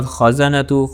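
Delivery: خزنۃ with a thick, full خ; heavy kha demo. Shown as a demonstration. Teacher shows students the heavy خ, slow. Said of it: "خا پھر موٹے letters میں سے ہے".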